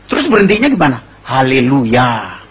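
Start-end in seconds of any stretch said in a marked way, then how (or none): 1.2-2.4 slow, lower-pitched hallelujah; drawn-out stop command. Acted. Horse owner teaching the stop word, slow and drawn-out. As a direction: stretch every syllable calmly.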